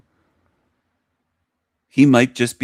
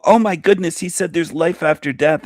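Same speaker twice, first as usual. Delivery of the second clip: mock excitement; 6 semitones higher than usual. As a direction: parody their breathless joy. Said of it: "jump for joy and go".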